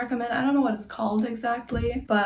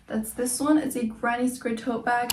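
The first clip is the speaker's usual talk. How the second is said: ordinary talk, nothing marked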